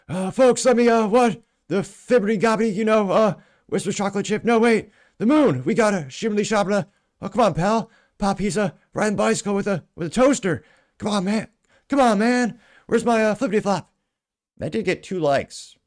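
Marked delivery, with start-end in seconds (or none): 0.1-1.4 much higher, louder mock old-man ramble; hammed-up doddering politician, stammering; Joe Biden impression. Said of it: "I should do this in Joe Biden's accent". 1.7-13.9 much higher, louder mock old-man ramble; hammed-up doddering politician, stammering; Joe Biden impression. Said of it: "I should do this in Joe Biden's accent".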